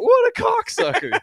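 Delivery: high, slow, outraged squeal at the snub; drawn-out high mock disgust. Retelling being ditched at lunch, a high mock squeal. Retelling his own reaction.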